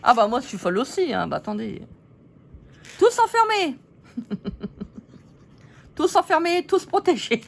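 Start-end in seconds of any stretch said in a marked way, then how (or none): 3.0-3.8 higher, louder announcement, half laughing; playful cry. Acted. Playful shout, all shut in. 6.0-7.4 higher, louder announcement, half laughing; playful cry. Acted. Repeats gleefully.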